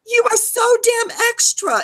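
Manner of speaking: high-pitched exasperated jab; theatrical high-voiced reproach; exasperated scolding. Scolding the whisperer.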